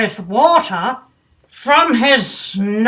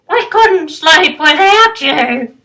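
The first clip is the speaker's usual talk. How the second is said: high, louder, pleading fish voice; grumpy fish, softened; 6 semitones higher, 8 dB louder than usual. Acted. Grumpy Gills asking Bernard to come back, high and pleading. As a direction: plead with him to come home. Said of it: "said Grumpy Gillies".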